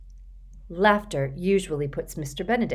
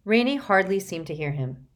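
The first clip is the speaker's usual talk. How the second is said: ordinary delivery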